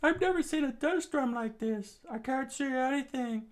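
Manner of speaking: very high falsetto character voice; alarmed fighter's voice. Acted. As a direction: pipe up far above the narration. Fighters blinded by sandstorm.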